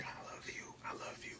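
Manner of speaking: soft unvoiced whisper, teasing; taunting whisper. Woman taunting him mid-meeting, whispered. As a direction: breathe it like a secret taunt.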